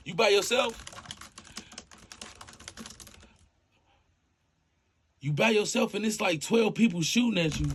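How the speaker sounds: high and loud the whole way, gamer hype; hyped up. Acted. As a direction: yell like you're in the game with him. Dispatcher hyped coaching shootout game.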